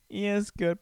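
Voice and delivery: silly high-pitched echo. Pretend. Host echoing his own remark, silly high voice. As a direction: repeat it in a playful high voice. Playful repeat continues.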